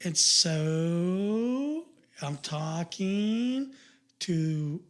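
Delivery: very slow, each syllable pulled long. Shown as a demonstration. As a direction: keep stretching the words out lazily. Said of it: "I'm starting to hear the influx there".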